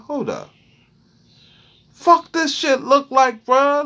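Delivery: high, frantic squeal of panic; shrieking in alarm at what he sees; panicked freakout. Retold or imitated. A man staring at a rash in panic, high frantic squeal.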